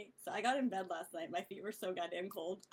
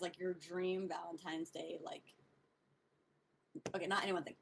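usual speaking voice

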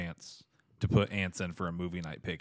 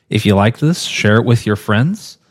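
loud; 14 dB louder than usual. Real, not acted. Loud podcast outro.

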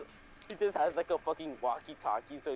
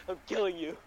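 normal talking voice

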